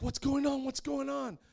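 shocked at the sight of friends on the wall; alarmed. Retold or imitated.

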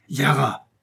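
acted fatherly anger, kept in check; furious. A narrator's reading. Father scolding his daughter in the wardrobe, furious but understated. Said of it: "dit son père furieux".

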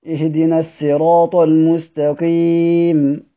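slow Quranic recitation of الصراط with an s sound; recited with sin. Shown as a demonstration. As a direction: recite it slowly, السراط with sin. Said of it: "والصراط بالسين". (تلاوة بطيئة بالسين)

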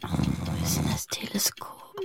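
whisper. Acted. Searching quietly at night.

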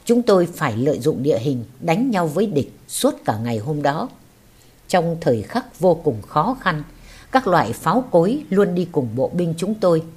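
usual speaking voice

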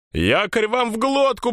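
put-on high, scolding old pirate woman; cursing grandma. A narrator's reading. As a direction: curse the sailors out. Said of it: "бранилась баба Сандра". (бранящаяся бабка-пиратка)

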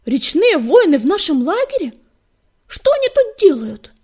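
high, loud, indignant questioning; alarmed disbelief. A narrator's reading. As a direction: cry out in shocked outrage. Shocked cat confronts him.

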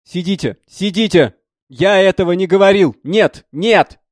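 high, very loud frantic insistence; stubborn shouted denial. A narrator's reading. Young artist insists he never said it, shouting stubbornly. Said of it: "он упрямо таращил на меня свои глаза и твердил".

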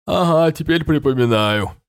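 yawning as he speaks; sleepy, through a yawn. A narrator's reading. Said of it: "зевнул король Тробиус". (зевая)

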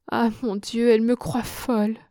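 mild narrated sob, breaking out in dismay; a touch of a sobbing lament; sanglot joué. A narrator's reading. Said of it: "sanglota Germaine". (sanglot contenu, désespéré)

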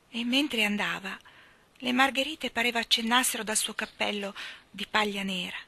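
ordinary delivery, nothing marked

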